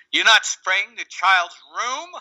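slow, high and loud disbelief; imitating the customer's outrage, drawn out; indignant question.

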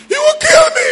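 high, loud cry of fear; desperate cry. Retold or imitated. Father crying out to the pastor in fear.

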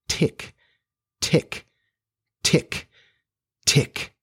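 short, spaced-out clock ticks; ticking imitation. A narrator's reading. Narrator imitates the nearing crocodile's sound, crisp spaced ticks.

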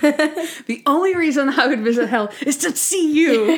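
laugh-tinged imitation of an insult; acted retort. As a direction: fling it at a rival with mock scorn. Acts out Monk's jab at Harold.

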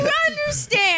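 hammed-up delight at a breakthrough; mock excited cheer. Podcaster celebrates the confused co-host understanding, mock excited. Cheering newcomer finally following along.

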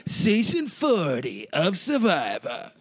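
slow, high, sing-song show intro; hammed-up announcer. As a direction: announce it like an epic reality-show opener.

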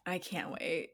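soft unvoiced whisper. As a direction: breathe "I can't wait." with no voice at all. Mock dread about aging.

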